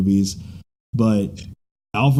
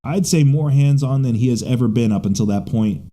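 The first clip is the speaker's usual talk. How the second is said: usual speaking voice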